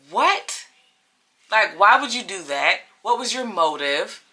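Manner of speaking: high-pitched, disbelieving "what" then insistent questioning; incredulous demand. Retold or imitated. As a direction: act out stunned, indignant questioning. Acted disbelief after hanging up.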